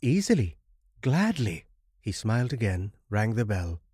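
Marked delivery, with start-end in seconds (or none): 0.0-1.6 high, louder, glad reply; eager acceptance. A narrator's reading. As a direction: jump at the offer gladly. Traveller accepts the offered help.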